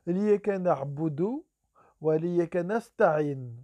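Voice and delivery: slow, measured Arabic recitation; deliberate slow reading of Liyaka na'budu. Shown as a demonstration. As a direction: recite the verse slowly. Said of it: "Je répète".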